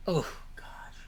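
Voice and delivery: hushed whisper, no voice. Real, not acted. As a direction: drop to a secretive whisper. Hushed aside about temptation.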